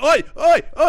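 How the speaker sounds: high, loud repeated "oi"; hammed-up pestering yells; mock shouting. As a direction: act out hassling someone right back. Acting out smacking a driver.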